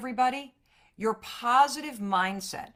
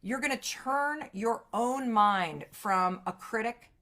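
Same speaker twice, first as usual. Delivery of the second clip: ordinary talk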